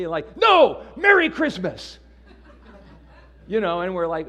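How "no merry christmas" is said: much higher and louder, slow, a hammed-up correction; mock indignant retort. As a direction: snap it back like an offended shopper. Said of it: "get angry around Christmas time".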